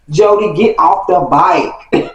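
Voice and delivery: slow, spaced-out final warning; slow, emphatic order. Retold or imitated. Kid warning Jody before a fight, slow emphatic order. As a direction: draw out each word as a last order.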